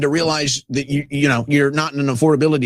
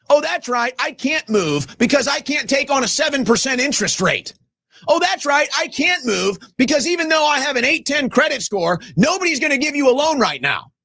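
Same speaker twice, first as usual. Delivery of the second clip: higher, shouted mock "oh that's right"; sarcastic rant; 7 semitones higher than usual. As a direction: hammer the sarcasm, voice up. Sarcastic rant mocking his own situation.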